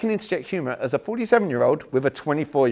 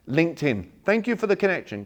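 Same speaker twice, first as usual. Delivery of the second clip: mock canned message; 6 semitones higher, 4 dB louder than usual. Mocking a canned LinkedIn message.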